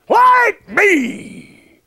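booming high divine command; God calling out creation in a loud shout. Retold or imitated. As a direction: thunder it out as God. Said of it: "God says".

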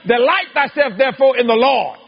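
"Delight thyself" cried out high and loud; preached verse. Shown as a demonstration. Preacher proclaiming the verse to the congregation, fiery. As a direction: proclaim the verse at full force. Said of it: "It says".

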